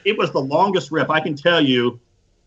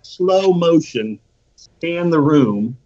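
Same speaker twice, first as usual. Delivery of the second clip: words stretched out slowly, a little louder; mock slow motion; 4 dB louder, much slower than usual. Storyteller scanning the gym for a spotter, in mock slow motion.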